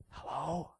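put-on tiny, hushed child on the phone; little kid's voice. Little kid answers the boss's call, tiny hushed voice. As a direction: answer very softly, like a small child. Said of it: "this little kid's voice".

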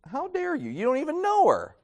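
put-on offended young preacher snapping back in his head; indignant thought. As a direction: bristle with hurt pride. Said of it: "I was really offended by that".

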